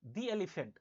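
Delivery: "The" said "dee" before elephant. Shown as a demonstration. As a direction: say "The" with a long ee. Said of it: "क्योंकि ए sound आ रहा है".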